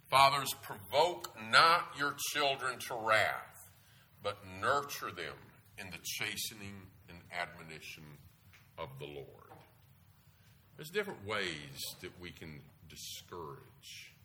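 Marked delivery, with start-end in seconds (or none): 0.1-9.5 slow, loud, weighty reading, stress on not; solemn scripture. Acted. Preacher reading Ephesians to fathers, slow and emphatic. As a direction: preach the verse slowly and firmly. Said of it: "Ephesians six four".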